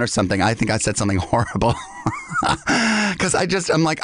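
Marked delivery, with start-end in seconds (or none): 1.8-3.1 a sudden high burst of laughter. Real, not acted. Laughing at what she said to a stranger, high laugh. Laughing at her own horrible remark.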